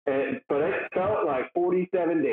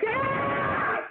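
mock scream. Retelling a stranger screaming at him, a high mock yelp. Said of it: "screaming at me and shit".

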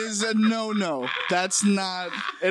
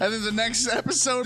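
ordinary talk, nothing marked

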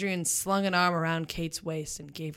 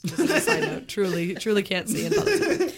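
laughing; 3 semitones higher, 5 dB louder than usual. Real, not acted. Laughs at her own misreading.

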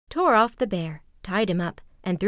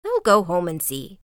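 plain reading voice

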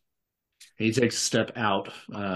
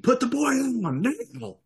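high, tense order from an armed thug; menacing demand. Acted. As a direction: order the boy released, tight and high.

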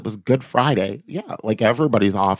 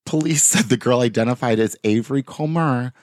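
ordinary talk, nothing marked

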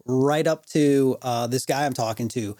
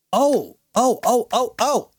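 high, louder excited oohs; higher, eager string of ohs; 9 semitones higher, 3 dB louder than usual. Real, not acted. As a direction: spark of remembering. Excited remembering.

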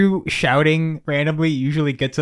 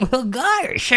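high squeaky cartoon duck; lightly voiced cartoon duck; 11 semitones higher than usual. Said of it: "the duck's just like".

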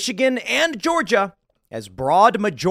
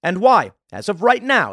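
normal talking voice

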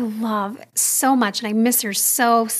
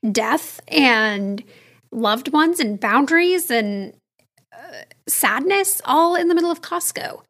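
normal talking voice

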